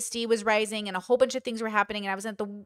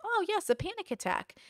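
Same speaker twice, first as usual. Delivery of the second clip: soft, higher-pitched, matter-of-course acknowledgement; unfazed recognition; 8 semitones higher, 7 dB quieter than usual. Retold or imitated. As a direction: greet it lightly like an old acquaintance. Repeats her unfazed reaction to others.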